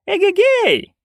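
high, loud hollering; calling out. A narrator's reading. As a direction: holler across the crowd. Nanny hollers to crowd.

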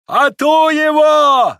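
shrieking high and loud, long vowels; drawn-out frantic yell; old man's wail. A narrator's reading. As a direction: howl it like setting dogs on someone. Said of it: "завопил старик".

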